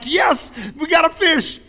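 high, loud, thrilled cry of catching a fish; excited shout. Retold or imitated. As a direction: yell it like you just hooked one.

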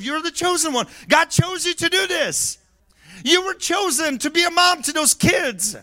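high, loud preaching shout; full-force preaching. Acted. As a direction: preach it out, building to a roar.

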